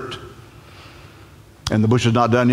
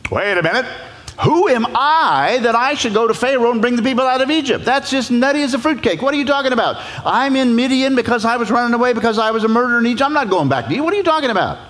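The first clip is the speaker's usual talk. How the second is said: much higher, protesting reluctant Moses; panicky Moses; 12 semitones higher, faster than usual. Acted. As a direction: squeal your objections to the bush.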